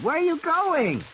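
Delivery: put-on high child's call; startled little girl. Lex calls after Ed Regis as he leaves the car. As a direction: call out after him in alarm. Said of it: "Lex said".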